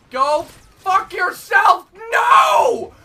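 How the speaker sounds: loud, slow, drawn-out yell; furious outburst; raging yell. Real, not acted. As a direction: let it rip at the screen. Raging at enemy.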